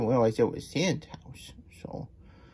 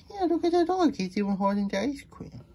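very high, squeaky delight; high excited aside; 14 semitones higher than usual. A narrator's reading. Onlooker spotting the dog holding ice cream, high delight. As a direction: exclaim it way up high.

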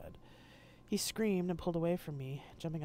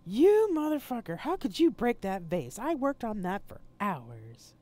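screaming in rage, much higher and louder; furious scream; 6 semitones higher, 9 dB louder than usual. Acted. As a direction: shriek at him over the broken vase. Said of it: "She screamed again".

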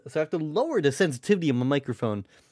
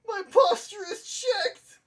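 much higher, slow, wailing shout; mock wailing cry; slower than usual. Repeating to chat, a hammed-up high wailing cry. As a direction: wail it out like a hammed-up lament.

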